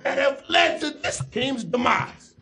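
indignant sports pundit, emphatic pauses; Stephen A. Smith. Acted. Sports pundit blaming the front office, indignant impression. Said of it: "another question for Stephen A".

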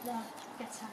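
very soft hushed aside; whispered aside. Real, not acted. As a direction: keep it hushed behind the camera. Hushed filming.

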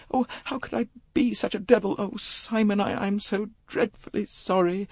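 put-on very high, sobbing young woman's apology; broken murmurs. A narrator's reading. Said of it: "He heard broken murmurs".

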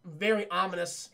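normal talking voice

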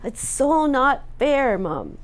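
high slow so not fair, dropping on Mom; whiny teen. Retold or imitated. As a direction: imitate a complaining teen. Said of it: "But what my kids were saying was".

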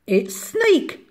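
high little mouse voice; cheery mouse. A narrator's reading. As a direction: chirp it as a confident mouse. Mouse greets snake in story.

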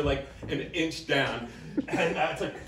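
ordinary talk

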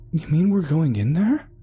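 high-pitched, dismayed question; alarmed disbelief. Acted. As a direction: balk at going into the caves. Pale Ada balks at caves.

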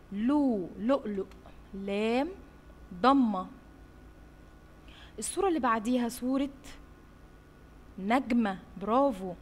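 ordinary talk, nothing marked

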